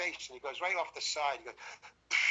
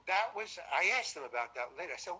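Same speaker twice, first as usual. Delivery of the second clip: normal talking voice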